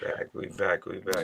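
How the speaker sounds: soft breathy echo; almost whispering we back; whispered echo. Real, not acted. Cohost echoing softly.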